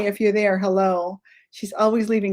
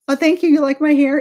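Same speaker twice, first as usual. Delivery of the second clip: higher and louder, delighted thanks; pleased surprise; 7 semitones higher, 7 dB louder, faster than usual. Real, not acted. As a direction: caught off guard by a compliment. Viewer compliments her hair.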